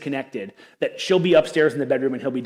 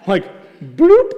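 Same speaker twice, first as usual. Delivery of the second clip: bloop; 4 semitones higher, 10 dB louder than usual. Retold or imitated. Leaving the call.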